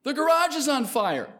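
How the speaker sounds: high, loud cry of alarm; panicked yell. Retold or imitated. Six-year-old alerting deacons down in the basement, panicked yell. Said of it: "and I yelled".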